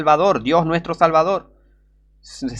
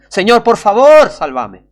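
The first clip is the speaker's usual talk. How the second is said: pleading cry; 6 semitones higher, 9 dB louder, slower than usual. Acted. Pleading prayer to God.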